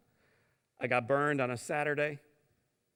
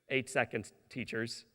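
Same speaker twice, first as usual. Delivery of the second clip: usual speaking voice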